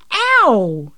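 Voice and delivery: loud, high cry of pain; yelped yell. A narrator's reading. Mum gets her toe pecked, yelling in pain. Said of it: "she yelled".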